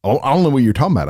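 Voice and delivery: fast, dismissive brush-off; quick puzzled denial; rushed innocent denial. Retold or imitated. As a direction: rattle it off like you're clueless. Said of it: "And I'm like".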